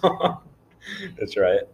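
laughing it off; slow laughing drawl. Real, not acted. Laughing after shout-out.

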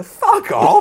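high, loud fed-up outburst; exasperated shout. Real, not acted. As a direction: blurt it out in disbelief.

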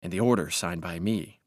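usual speaking voice

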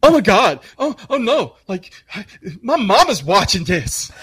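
high-pitched hammed-up horror; whiny, exaggerated dismay; mock panic. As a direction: imitate a mortified young guy. Imitates horrified young co-workers.

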